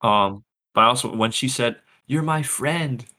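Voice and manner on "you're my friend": higher, lightly imitated Aussie kid; a hint of a young girl's Australian accent; little Aussie accent. Imitating young Omega's sweet Aussie accent to a friend. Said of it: "in her little Aussie accent".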